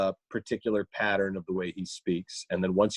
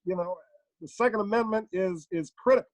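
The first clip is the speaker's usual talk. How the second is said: higher, emphatic statesman full of uhs and pauses; halting, both-sides presidential oratory; Barack Obama; 7 semitones higher than usual. Acted. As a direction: measure out each phrase, stuttering between them. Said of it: "if you ask him his opinions on guns".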